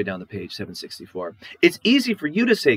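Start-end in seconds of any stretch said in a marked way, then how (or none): 1.6-2.8 high, loud, aggrieved outburst; wife protests to her husband about his mother, high and loud; upset wife. Acted. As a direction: pour out your grievance against him. Wife complains about mother-in-law.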